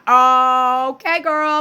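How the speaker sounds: sassy. Retold or imitated. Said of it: "I'm like".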